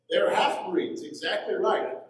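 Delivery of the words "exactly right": ordinary talk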